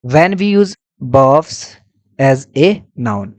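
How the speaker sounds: very slow, words pulled apart for notes; slow heading dictation. Shown as a demonstration.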